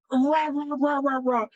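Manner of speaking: high-pitched nonsense grumbling; mock ranting. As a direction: imitate someone's rant as high rah-rahs. Imitates friend's ranting with nonsense.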